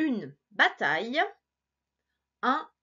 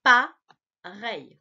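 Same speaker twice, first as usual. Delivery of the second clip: Appareil drawn out slowly and louder; slow sounding out; 7 dB louder, much slower than usual. Shown as a demonstration. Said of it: "essaie de lire avec moi".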